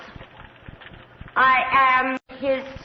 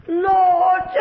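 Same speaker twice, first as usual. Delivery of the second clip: a louder, higher wail of lord; anguished cry; 5 semitones higher, 5 dB louder than usual. Acted. Countess wailing for her dead husband, anguished shout.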